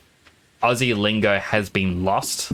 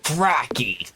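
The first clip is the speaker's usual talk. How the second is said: hammed-up Australian accent; Aussie exclamation. Streamer doing Aussie sound bites, hammed-up Australian accent. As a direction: exclaim it in broad mock Aussie. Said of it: "Aussie sound bites I could say".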